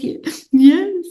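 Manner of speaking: a high, meek little yes; small tearful reply. Retold or imitated. Answering mentor Annie while crying, high meek voice. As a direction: answer timidly, like a kid caught crying. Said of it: "I said".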